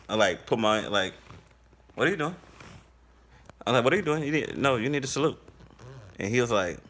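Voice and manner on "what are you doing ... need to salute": higher, pushy mock challenge; mock demanding. Calls out the chief for not saluting, higher and pushy. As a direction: play the strict door guard, higher.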